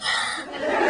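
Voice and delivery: short huff of air into a cupped hand; breath-check huff. Shown as a demonstration. Comic showing the hand breath test, short huff. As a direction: exhale sharply to test your breath. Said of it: "do that hand thing".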